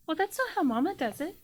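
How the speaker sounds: high-pitched little monkey voice; small, high creature chiding someone. Acted. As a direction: squeak it out as a small talking monkey. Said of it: "And she goes".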